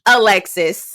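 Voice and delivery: slow, grand announcer voice; mock award announcer. Sarcastic award to Alexis, slow grand announcer. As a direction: announce it like the Oscars, drawn out. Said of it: "I said".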